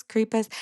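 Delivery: "crepes" in two syllables, CREE-puhs; different pronunciation. Retold or imitated. Guessing at a fellow teacher's pronunciation, "crepes" as CREE-puhs. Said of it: "She pronounced it different".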